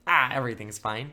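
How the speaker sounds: loud, breezy brush-off; carefree teens. Retold or imitated. Teens blowing off the danger, a loud carefree shrug. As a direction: toss it off like careless teens.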